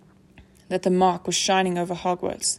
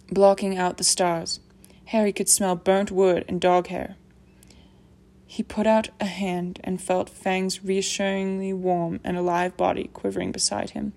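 ordinary delivery

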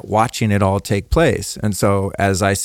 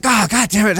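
mock exasperated curse, pitched much higher; retold irritated outburst; 10 semitones higher than usual. Telling friends about getting lost in traffic, mock exasperated curse. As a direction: replay the flash of road rage, half-joking. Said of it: "I get super pissed off".

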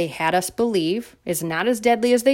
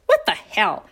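outraged disbelief; 13 semitones higher than usual. Real, not acted.

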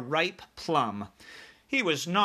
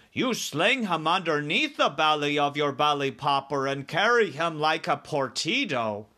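higher giant voice, louder; BFG instructing; 4 semitones higher, 4 dB louder than usual. Acted. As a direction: explain it as the giant.

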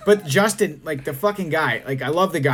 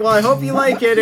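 higher, louder cheery imitation; eager well-wishing; 5 semitones higher, 5 dB louder than usual. Imitating girlfriend about the birthday present, eager. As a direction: gush hopefully over the gift. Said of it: "And she's like".

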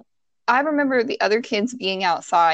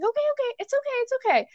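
high, soft reassuring okays; calm a panicking child, softly and high; imitated soothing teacher; 4 dB quieter, much faster than usual. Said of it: "She's like".

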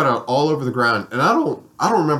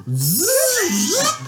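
imitated zipper sound. Tent zipper being opened.

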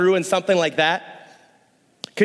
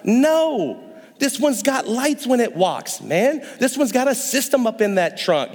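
higher, showing-off owner's voice; proud owner in a higher voice showing off his new donkey; bragging donkey owner; 6 semitones higher than usual. Retold or imitated. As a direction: brag about your tricked-out ride.

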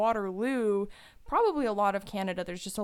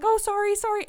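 flustered apology; 12 semitones higher, 8 dB louder than usual. Retold or imitated. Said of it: "she lifts up her dog".

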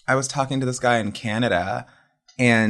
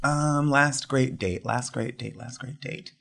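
fading musing repeat; 7 dB quieter, slower than usual. Real, not acted. Repeats while thinking, fading.

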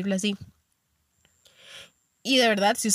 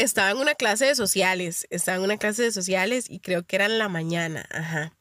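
ordinary delivery

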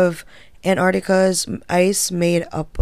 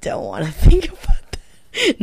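laughing; 9 semitones higher than usual. Real, not acted. Laughing in disgust.

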